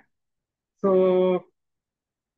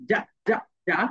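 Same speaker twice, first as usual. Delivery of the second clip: British-style "যা" repeated; British z sound; 4 semitones lower than usual. Shown as a demonstration. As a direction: drill the British "যা". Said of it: "ব্রিটিশরা বলবে".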